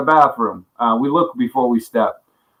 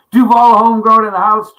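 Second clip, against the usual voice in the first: hyped, loud greeting; loud shoutout; 7 semitones higher, 6 dB louder than usual. Real, not acted. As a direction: call it out to the chat. Greeting stream viewer loudly.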